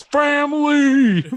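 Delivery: huge drawn-out robot bellow of Family; Iron Giant shouting Family. Retold or imitated. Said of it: "He says".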